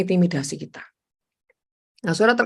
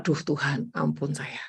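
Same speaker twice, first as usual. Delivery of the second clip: soft, low sigh of regret; hushed and contrite; hushed remorse; 6 semitones lower, 11 dB quieter than usual. Retold or imitated. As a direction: murmur it like a quick confession. Preacher retells own quick repentance.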